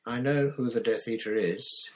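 ordinary delivery

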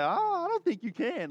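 lightly voiced teasing wife. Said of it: "She's like".

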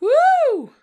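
high, loud party whoop; loud whooping cheer. Real, not acted. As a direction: cheer her on like a crowd. Cheering the strip scene.

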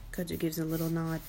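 ordinary delivery, nothing marked

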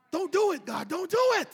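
loud, high, pleading with God; loud, high plea; pleading shout. Retold or imitated. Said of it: "Why should the Egyptians say".

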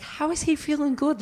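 high-pitched incredulous whine; retold indignant question, squeaking high; mock disbelief. Retold envious disbelief mid-run.

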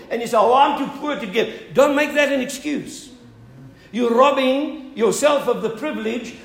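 loud, high sermon appeal; fervent preaching. Real, not acted. Pastor urges poor congregation to give, preached loudly. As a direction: press the congregation hard, preacher style.

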